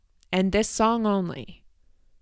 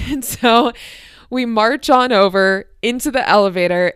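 ordinary delivery

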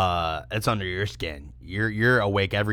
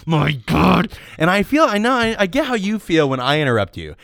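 higher, louder playful rant; mock outburst; 9 semitones higher, 6 dB louder than usual. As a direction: playfully blow up at him.

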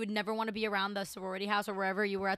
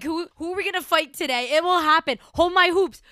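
ready to fight; 6 semitones higher, 8 dB louder than usual. Retold or imitated. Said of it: "I'm like".

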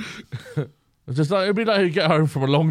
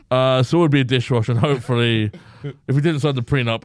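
ordinary talk, nothing marked

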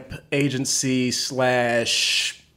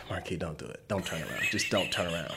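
much softer, low self-warning; inner voice; 8 semitones lower, 16 dB quieter, faster than usual. Retold or imitated. Man quietly telling himself not to turn around. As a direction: murmur it to yourself to stay calm. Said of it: "I'm like".

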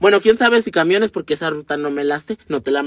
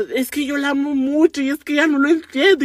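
mock crying; 8 semitones higher than usual. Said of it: "el chavo empezó a llorar".

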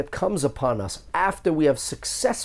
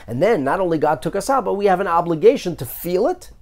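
building intensity; 6 semitones higher, 4 dB louder than usual. Real, not acted. Building argument to listeners.

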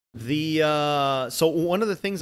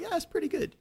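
much higher, soft impressed voice; impressed squeak; 5 dB quieter than usual. Retold or imitated. Fan reacting to a movie theory, high impressed squeak. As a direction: squeak it, pleasantly surprised. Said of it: "I'm like".

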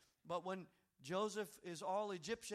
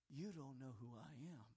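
hushed reveal; 4 semitones lower, 9 dB quieter than usual. Acted. Joseph revealing himself to brothers.

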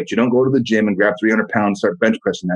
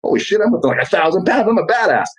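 high, gleeful boast; mock triumph; 8 semitones higher than usual. Host imitating a gym-goer who got strong, excited boast. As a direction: crow like you just out-lifted everyone. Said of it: "you're like".

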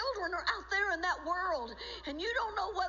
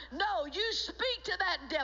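usual speaking voice

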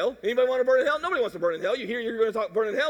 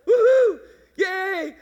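mock cheer, much higher and louder; 9 semitones higher, 9 dB louder, much slower than usual. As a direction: whoop like a crowd celebrating. Celebrating a decision.

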